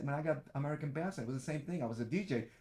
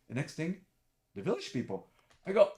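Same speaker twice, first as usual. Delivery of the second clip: ordinary talk, nothing marked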